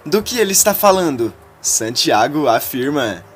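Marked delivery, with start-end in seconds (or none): 0.0-1.3 high, quick anxious question; nervous question. A narrator's reading. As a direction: ask it fast and nervous, pitched up.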